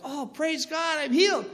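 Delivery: high, joyful exclamation; joyful praise. Retold or imitated. Man feeling the pain leave, high joyful praise. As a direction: burst out in happy relief.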